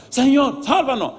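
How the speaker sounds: high, loud cry for rescue; panicked plea, acted. Disciples in the storm beg Jesus to save them, hammed-up panic. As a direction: mimic frantic men calling for help.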